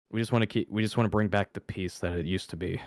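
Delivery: wearily, with a heavy sigh; tired sigh. Acted. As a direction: sigh it out like a worn-out guard. Guard speaking wearily, sighing.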